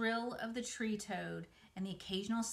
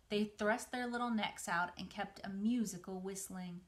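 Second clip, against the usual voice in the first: usual speaking voice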